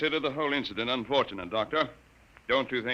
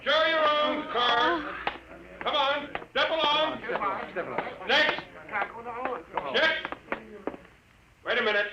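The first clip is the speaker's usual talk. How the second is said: barked orders; 12 semitones higher than usual. Acted. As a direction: bark it out to the crowd. Guard barking orders at checkpoint.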